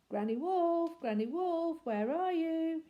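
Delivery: little wolf calling for granny upstairs. A narrator's reading. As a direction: call through the house. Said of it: "he called".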